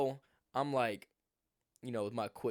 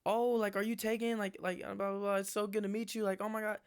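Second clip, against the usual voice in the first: put-on much higher, gushing teen-girl voice; mock gushing girl; 9 semitones higher than usual. Little sister gushes at the visitor, high mock girly voice. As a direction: ham up an excited little girl. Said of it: "Her sister come out like".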